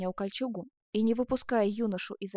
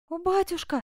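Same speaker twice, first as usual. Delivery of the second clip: high cry of a daughter; raised, emotional exclamation; 8 semitones higher, 3 dB louder than usual. A narrator's reading. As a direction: cry out to father, pitched high. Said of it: "воскликнула она".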